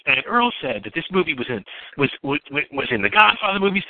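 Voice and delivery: high mocking girl voice. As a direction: mimic gossiping girls, pitched high. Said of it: "They're like".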